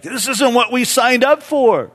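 high, loud mock protest; complaining crowd. Disappointed crowd at the week's end, complaining loudly. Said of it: "they're like".

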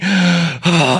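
heavy exhausted breath out; panting. Acted. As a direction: pant like a worn-out swimmer. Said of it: "he's panting".

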